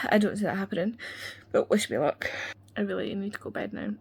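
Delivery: ordinary talk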